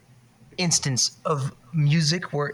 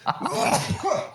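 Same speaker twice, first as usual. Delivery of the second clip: sneezing. Real, not acted. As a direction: let out a real sneeze. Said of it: "Bless you".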